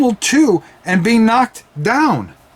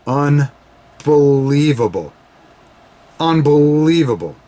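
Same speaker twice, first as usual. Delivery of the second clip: slow, lower, awed unbelievable; stunned awe; 4 semitones lower, slower than usual. Acted. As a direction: let the disbelief sink in. Commentator awed after kick-out.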